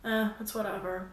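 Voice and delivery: bored passenger brushing off the driver's greeting; uninterested. Pretend. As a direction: make clear you don't want to chat. Role-playing an uninterested passenger.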